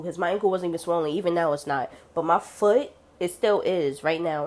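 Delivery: usual speaking voice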